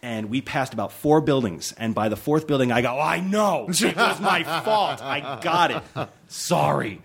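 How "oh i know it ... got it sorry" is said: slow, drawn-out exasperated admission; exasperated mock apology. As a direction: give in after being blamed repeatedly. Mock apology to cab driver.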